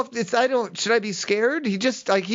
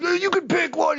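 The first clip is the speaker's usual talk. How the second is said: much higher, eager pitch; hammed-up friendly car-wash guy; eager salesman; 11 semitones higher than usual. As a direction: sell the air freshener gleefully. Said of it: "he's like".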